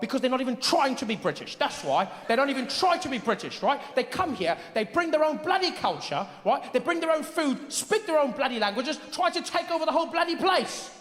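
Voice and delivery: high, aggrieved British voice; British anti-immigrant ranter. Retold or imitated. Brexit voter complaining about immigrants, high indignant rant. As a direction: rant in a worked-up British accent. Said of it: "People saying the weirdest things".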